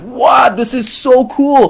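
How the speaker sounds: high, slow, wide-eyed "so cool"; amazed excitement. Retold or imitated. Student discovering the Tantras, slow and high with awe. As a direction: draw it out in delighted awe.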